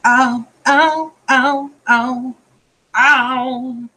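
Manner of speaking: real yelps of pain, pitched high. Real, not acted. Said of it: "the reason I'm saying ow".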